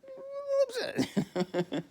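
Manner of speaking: squealing laugh-cry understatement; mock whimper through laughter. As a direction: wail a little upset while cracking up.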